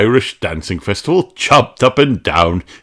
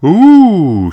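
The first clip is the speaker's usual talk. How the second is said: excited ooh; 10 semitones higher, 8 dB louder than usual. Acted. Mummy Pig excited at shop.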